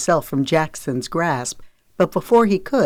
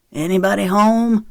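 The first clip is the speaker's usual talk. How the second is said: raised, higher-pitched call; calling out loudly; 4 semitones higher, 4 dB louder than usual. A narrator's reading. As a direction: call into the house to see who's in. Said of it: "he called loudly".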